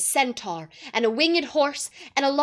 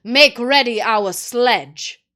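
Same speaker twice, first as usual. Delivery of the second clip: slow, loud commanding witch; the witch commands the dwarf; ordering; 13 dB louder, slower than usual. A narrator's reading. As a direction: give a cold royal order. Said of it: "ordered the witch".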